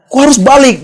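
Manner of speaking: high, loud imitated boss; high-pitched mock outrage; incredulous objection. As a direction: protest as the exasperated boss would. Voices boss's incredulous objection.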